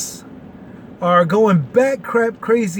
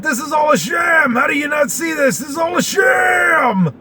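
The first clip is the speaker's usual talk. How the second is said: much higher, shouted mock outrage; mock outraged shout; 8 semitones higher than usual. Imitating the other side yelling, higher mock outraged shout. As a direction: yell it like an indignant partisan. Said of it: "the other side of the aisle, you got".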